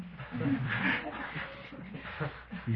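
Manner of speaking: high-pitched, softening laugh. Real, not acted. Speaker amused by father scolding his son, laughing. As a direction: laugh at the line you just quoted.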